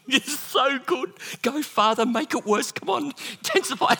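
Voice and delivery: high-pitched comic delight, held in; gleeful egging on. Acted. Jesus feigning sleep urges Father to worsen storm, gleeful egging on.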